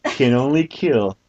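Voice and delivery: high, slow, solemn nature-spirit voice; mystical elder voice. Acted. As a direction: intone it like a wise forest elder. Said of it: "why that voice had to be".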